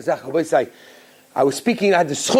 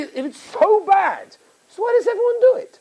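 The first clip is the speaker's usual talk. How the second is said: incredulous; 12 semitones higher than usual. Acted. Rhetorical outburst repeating the question.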